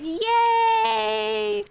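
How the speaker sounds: high, squealed yay; mock cheer. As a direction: cheer it like a delighted relative. Relatives cheering after baptism.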